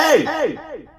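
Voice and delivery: high-pitched yelling hey; mock high yell. Yelling at the Uber driver to get her attention, high mock shout.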